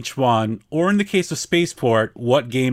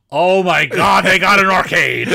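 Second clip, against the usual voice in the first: loud, high gasp of discovery; suddenly spot the arcade, thrilled; amazed surprise; 5 semitones higher, 7 dB louder than usual. Retold or imitated. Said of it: "be like".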